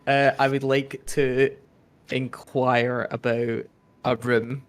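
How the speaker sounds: slow, careful in-character request; measured, halting politeness; slow, deliberate. Acted. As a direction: player asking innkeeper, slow. Player speaking in character.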